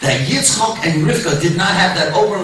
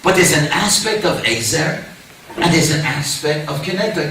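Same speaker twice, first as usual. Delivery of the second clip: normal talking voice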